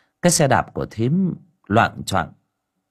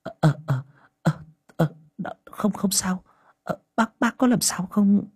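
higher, stammering woman's voice; flustered woman; 9 semitones higher than usual. A narrator's reading. Liên, having crashed into a man, stammers flustered.

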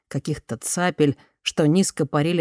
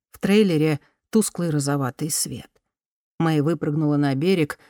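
ordinary delivery

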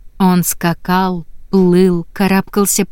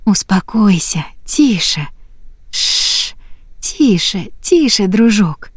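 whispering; slower than usual. A narrator's reading. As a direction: hush the horse in a whisper. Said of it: "зашептала". (шёпотом успокаивая коня)

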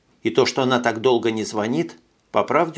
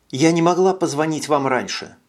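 usual speaking voice

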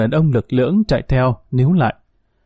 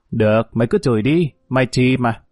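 plain reading voice

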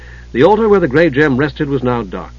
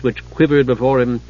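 usual speaking voice

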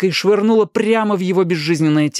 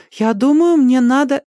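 put-on high child's voice; puzzled boy; 11 semitones higher, 4 dB louder than usual. A narrator's reading. Danny drawn toward the light, puzzled. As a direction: reason it out like a bewildered boy. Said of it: "озадаченно ответил Дэнни".

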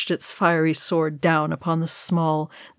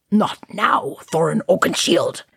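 lightly snapped wizard's rebuke. A narrator's reading. Wizard cutting off Thorin's question, snapping. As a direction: cut him off with a firm, impatient snap. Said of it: "Gandalf snapped".

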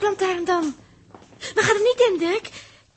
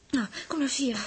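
soft unvoiced whisper; 4 semitones lower, 6 dB quieter, faster than usual. [name] whispers to Dirk to come closer. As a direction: draw him close in a whisper.

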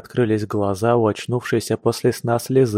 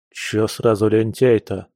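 usual speaking voice

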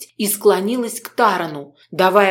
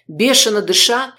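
ordinary delivery, nothing marked

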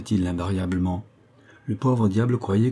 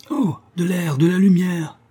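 much higher, louder cry of relief; joyful shout; 9 semitones higher, 4 dB louder than usual. A narrator's reading. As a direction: shout out at the sight of daylight. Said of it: "hurla Benoît". (cri de joie en voyant le ciel)

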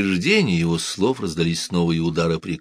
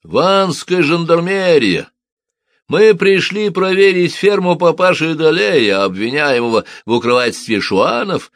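loud, much higher announcing shout; official shout; 9 semitones higher, 7 dB louder than usual. A narrator's reading. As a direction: announce yourselves loudly at the gate. Fake gendarmes announce themselves.

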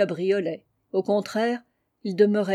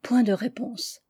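ordinary delivery

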